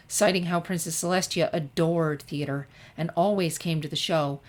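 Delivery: ordinary delivery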